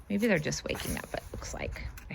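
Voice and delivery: hushed unvoiced aside; whisper. Real, not acted. Reader whispering a side comment about the sleeping buggles, hushed. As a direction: whisper so the sleepers stay asleep.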